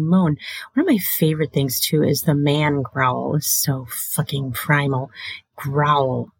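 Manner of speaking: usual speaking voice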